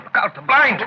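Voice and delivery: loud, high sudden warning; urgent warning shout. Acted. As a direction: snap out a sharp warning. Warning about the window blind.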